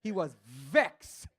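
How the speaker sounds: slow, drawn-out West Indian "vexed"; hint of a West Indian accent, slowed down. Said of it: "He was a West Indian".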